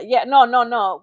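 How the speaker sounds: ordinary talk, nothing marked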